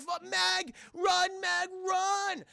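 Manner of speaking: high, urgent mock shout; hammed-up desperate yell; urgent warning. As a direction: holler a warning from far above. Maitre d' warns Meg.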